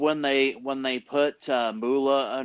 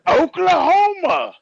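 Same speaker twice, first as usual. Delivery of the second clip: high wrestler voice; 14 semitones higher, 5 dB louder than usual. Retold or imitated. Quoting the wrestler's name.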